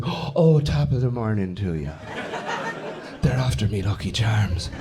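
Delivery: hammed-up Irish lilt; badly faked Irish brogue; mock Irish accent. People going up to Irish people, mock Irish accent. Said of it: "don't go up to Irish people".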